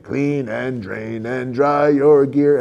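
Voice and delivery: imitating kids in a lightly voiced pirate. Kids with eye patches singing, a hint of pirate. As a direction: growl it a little like kids playing pirates. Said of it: "they'll be like".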